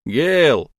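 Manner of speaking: loud anxious call across the lake. A narrator's reading. Calling missing girl louder.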